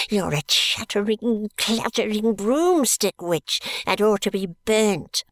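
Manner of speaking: slow, high, spitting curses; old miser cursing between gasps; gasping old man. A narrator's reading. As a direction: hurl slow gasping insults at his wife. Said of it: "gasps the old man".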